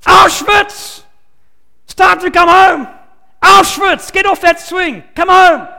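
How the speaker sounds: loud calling shout, high and hammed; a parent hollering over the playground noise; shouting across the playground. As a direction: yell the child's name home from the playground. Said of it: "at the top of your voice shouting out".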